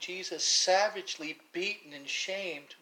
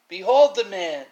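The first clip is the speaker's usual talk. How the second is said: much louder proclamation, declaimed; Pilate's loud cry; 3 semitones higher, 16 dB louder than usual. Retold or imitated. Pilate repeating his cry to the crowd, loud proclamation. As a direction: call it out to the crowd once more. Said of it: "As soon as he cried".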